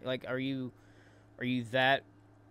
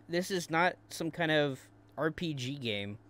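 ordinary talk

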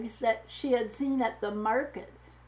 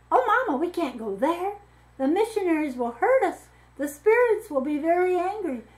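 put-on frightened girl; 7 semitones higher, 5 dB louder than usual. A narrator's reading. Frightened girl begs mother.

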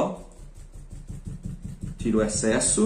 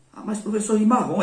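a pupil's lightly voiced whiny protest, pitched higher; a light put-on student voice, higher than her own; mock student objection; 8 semitones higher than usual. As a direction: play a doubting student protesting to the teacher. Imagined student questions the colour.

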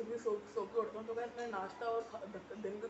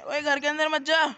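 higher, louder shout to shoo a puppy; calling out sharply; 6 semitones higher, 13 dB louder than usual. Real, not acted. Vlogger shooing a puppy from the house, raised shout. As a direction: call out to stop the pup going inside.

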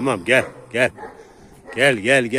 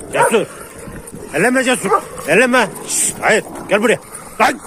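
yelling to stop a dogfight; 11 semitones higher, 3 dB louder than usual. Real, not acted. Stopping a dog fight.

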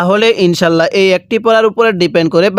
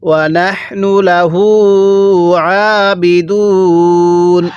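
slow, drawn-out tajweed chant; Quran recitation; much slower than usual. Shown as a demonstration. Teacher recites the verse for students, slow tajweed chant. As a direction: intone each syllable slowly like a reciter. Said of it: "এরকমভাবে পড়ব".

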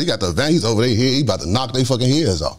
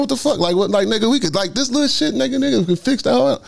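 high-pitched, agitated pleading; reenacted noisy protest; 13 semitones higher than usual. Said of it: "it's more like that".

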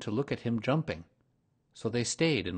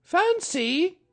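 very high, louder kangaroo mother; sing-song motherly greeting; 9 dB louder than usual. A narrator's reading. Kanga greets Pooh, very high and motherly.

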